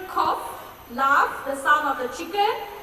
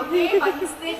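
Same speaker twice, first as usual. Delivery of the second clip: normal talking voice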